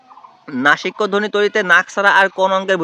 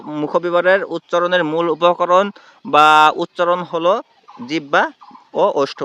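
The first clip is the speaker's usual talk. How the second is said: slow dictation; much slower than usual. Shown as a demonstration. Teacher repeats the question for dictation.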